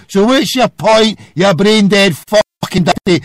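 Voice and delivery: normal talking voice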